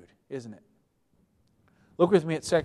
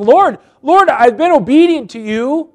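much higher, louder self-pitying complaint, fading at the end; self-pitying whine; 7 semitones higher, 10 dB louder than usual. Acted. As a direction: whine to God like a wronged child. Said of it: "feeling sorry for myself".

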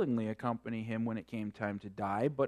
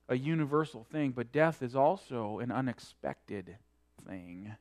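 normal talking voice

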